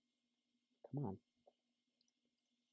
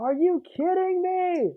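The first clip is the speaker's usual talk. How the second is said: very high, slow mock outrage; exaggerated disbelief; slower than usual. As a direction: ham up the disbelief. Echoing friend's game frustration.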